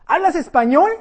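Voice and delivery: calling out to a stranger down the street; calling across distance. Retold or imitated. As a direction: shout it to a man far away. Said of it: "le grito a la distancia".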